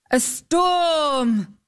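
high, drawn-out exclamation; exclaimed. A narrator's reading. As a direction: cry it out on a long, falling STORM. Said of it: "exclaimed the knight".

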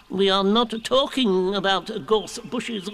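slow high tetchy owl voice; a little cross Owl. Acted. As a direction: correct them crossly. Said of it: "said Owl a little crossly".

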